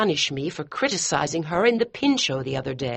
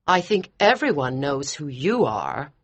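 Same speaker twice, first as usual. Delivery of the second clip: coldly; 6 semitones higher than usual. Acted. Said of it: "said Missus Walker coldly".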